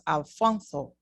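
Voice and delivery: Alfonso with a th for the s; Castilian Alfonso. Shown as a demonstration. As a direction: say Alfonso the Spain way. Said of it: "In Spain, however".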